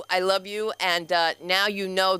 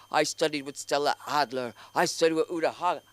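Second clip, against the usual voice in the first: lightly voiced pompous actor; snooty actor; 4 semitones lower than usual. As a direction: brag like a pretentious actor. Mocking pretentious actors.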